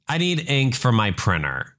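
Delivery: ordinary talk, nothing marked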